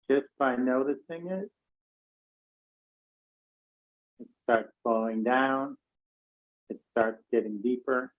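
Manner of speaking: calm meditation voice with long pauses; guided meditation. Real, not acted. Teacher leading an online class to notice their breath, calm and spacious. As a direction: guide them gently into noticing the breath, unhurried.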